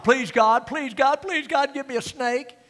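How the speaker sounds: rising, pleading please God, climbing each time; mock begging prayer. Congregant begging God for a snake, mock pleading. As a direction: beg like a child for a toy. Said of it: "when you pray".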